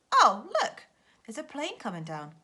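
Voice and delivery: high, loud excited cry; a child seeing a plane land; excited spotting. Acted. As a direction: point it out with a burst of excitement.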